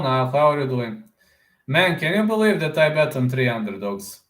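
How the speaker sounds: ordinary talk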